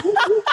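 high laughing whoop whoop like a police siren; mock siren whoop. Taunting the neighbour from the car, a high laughing siren whoop. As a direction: sing out the siren at him, laughing. Imitating siren while driving by.

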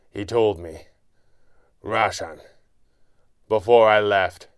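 broken phrases with long pauses; halting words. A narrator's reading. Wounded hero answers his enemy, halting. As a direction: struggle to get each phrase out. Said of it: "a few halting words".

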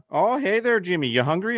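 high, chirpy hello, imitating family; bland Maine relatives. Maine family greeting their Southern cousin, imitated high and bland. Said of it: "they would say something very bland".

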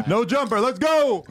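high, loud, excited outro call; hyped sign-off. Real, not acted. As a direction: pump up the send-off, high and loud. Closing the show, hyped.

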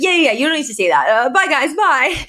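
fast, high and brisk, hustling guests out the door; rushing them out. Retold or imitated. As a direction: shoo them off, quick and chirpy. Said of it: "She's like".

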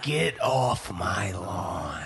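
Clint Eastwood impression. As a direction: drag it out in a deep mock shout. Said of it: "a Clint Eastwood".

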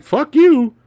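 high, loud retold curse; mock defiance. Host imitates her before she shoots lasers, mock defiance. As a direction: imitate a netted villain lashing out.

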